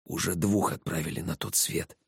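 soft, hushed voice into the ear; secretive reply. A narrator's reading. Slav answers into Inga's ear unheard, hushed. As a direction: keep it low-key so nobody overhears. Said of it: "наклониться к уху Инги, чтобы мой ответ никто".

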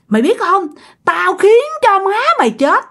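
high-pitched, vengeful boast; acted menacing curse; fierce threat. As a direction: threaten like a furious spirit. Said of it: "Làm rất là hung dữ".

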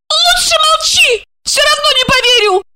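very high, fast tearful squeal; acted woman's high-pitched shriek. A narrator's reading. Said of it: "Александра взвизгнула".

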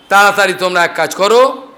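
plain reading voice

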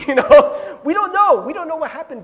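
high-pitched outburst, easing slightly at the end; high loud exclamation. Real, not acted. Laughing aside, suddenly high and loud.

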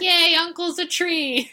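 put-on a high, loud, slow cheer of baby dragons; cheery baby dragons. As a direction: squeal it like carefree little dragons. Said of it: "baby dragons ... like".

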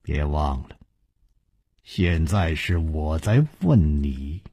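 low, soft, unruffled reminder; reply quietly with a hint of a smile; amused calm. A narrator's reading. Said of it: "楚留香笑着说".

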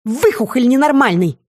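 high, indignant name-calling; angry insult. A narrator's reading. Seer insulting the shapeshifter, high angry jab.

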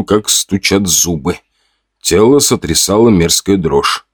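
ordinary delivery, nothing marked

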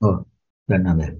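ordinary delivery

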